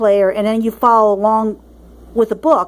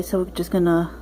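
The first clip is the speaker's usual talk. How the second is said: usual speaking voice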